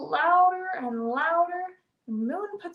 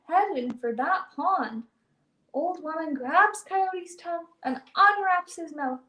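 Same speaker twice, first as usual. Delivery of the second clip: ordinary delivery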